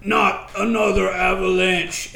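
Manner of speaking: slow, lightly voiced weary groan; weary Pock. A narrator's reading. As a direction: drag it out, fed up. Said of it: "you heard Pock's voice".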